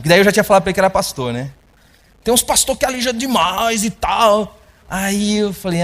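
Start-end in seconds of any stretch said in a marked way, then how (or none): 2.2-4.6 teasing quote. Retold or imitated. Repeats the man's jab.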